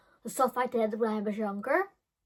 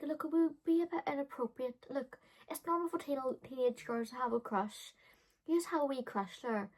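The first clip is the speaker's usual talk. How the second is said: softer, higher, patient adult voice; calm adult; 4 semitones higher, 5 dB quieter than usual. Retold or imitated. Paula gently explains the crush to the teen, soft. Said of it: "she's like".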